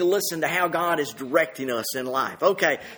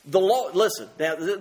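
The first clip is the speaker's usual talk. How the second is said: ordinary talk, nothing marked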